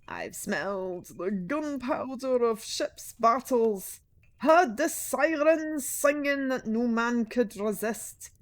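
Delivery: put-on slow, loud old salt spinning a yarn; old sea captain boasting in a slow, loud voice; deep raspy sea captain. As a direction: growl it out slow like a weathered captain. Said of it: "with a deep, raspy voice".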